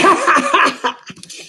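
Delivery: gloating villain laugh; wolf laugh. A narrator's reading. Wolf laughing at his captives. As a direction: cackle over the pot. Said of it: "Wolf laughed".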